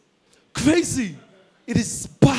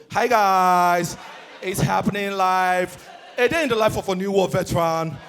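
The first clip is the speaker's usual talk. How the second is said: Gen Z livestreamer; 2 semitones lower than usual. Acted. Gen Z streaming from the battlefield, languid vlogger voice. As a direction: talk to followers on a live stream, stretching line endings. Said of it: "the Gen Zs".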